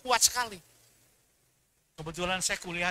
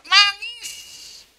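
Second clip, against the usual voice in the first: loud, high wail on "Nangis"; mock sobbing; 13 semitones higher, 8 dB louder than usual. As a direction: wail the word like a hammed-up sob. Preacher mimics man weeping.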